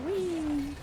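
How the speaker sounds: high, breathy whee; gleeful squeal. Pretend. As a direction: squeal it like a kid on a slide. Gleeful squeal as the character slides.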